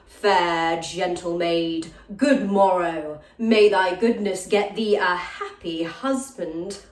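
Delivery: slow, measured imitation of a noble suitor; gentleman's courtly greeting. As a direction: mimic his formal salute, unhurried and stately. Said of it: "then salutes me thus".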